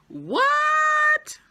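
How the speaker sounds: high loud shriek of surprise; shocked. Real, not acted. Reacting to Franklin at Avi's table, shrieking surprise. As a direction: yelp in disbelief.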